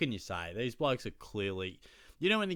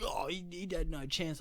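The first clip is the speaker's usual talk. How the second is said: higher-pitched arguing footy fan; imitated protest; 8 semitones higher than usual. Rival fan arguing back over the free kick, mock protesting. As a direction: mimic a fan disputing the call.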